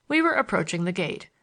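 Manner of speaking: plain reading voice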